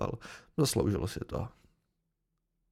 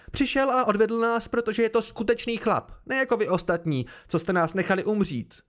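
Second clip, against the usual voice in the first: high, louder angry reproach; vzteklý výkřik; 7 semitones higher, 4 dB louder than usual. A narrator's reading. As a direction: lash out at the men, pitch raised. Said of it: "vykřikla Kryn vztekle". (vzteklá hlasitá výčitka)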